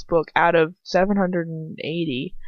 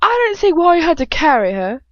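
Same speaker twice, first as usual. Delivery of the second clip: put-on high, loud English-accented villainess; Bellatrix; 9 semitones higher, 6 dB louder than usual. Rehearsing before reading aloud, demented English voice. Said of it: "Bellatrix's voice".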